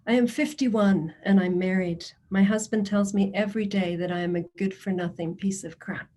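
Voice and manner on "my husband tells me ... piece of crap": ordinary talk, nothing marked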